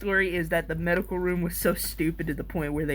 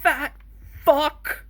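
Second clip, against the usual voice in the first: mock angry yell; 7 semitones higher, 3 dB louder, slower than usual. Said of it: "He went like".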